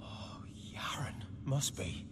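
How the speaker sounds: hushed, breathless whisper. Acted. Peasant whispering on seeing the wounded Yaren. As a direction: whisper the name in shock.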